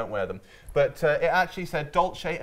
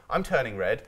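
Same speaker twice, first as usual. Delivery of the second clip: normal talking voice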